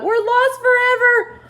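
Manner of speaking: very high loud shout. Acted. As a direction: yell agreement in alarm. Ladybug despairs.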